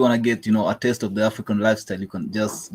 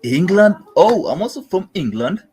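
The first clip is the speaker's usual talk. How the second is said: loud, higher fake British accent; hammed-up British accent, pitched up; 8 semitones higher, 7 dB louder than usual. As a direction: overplay a British accent to sound English. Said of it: "Why are you sounding like you from Holland".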